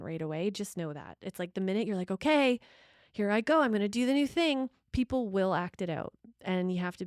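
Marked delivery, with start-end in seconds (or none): 2.1-4.7 higher, louder, psyching up; a burst of mock courage; mock brave start. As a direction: announce it like a bold new step. Acting out brave first step.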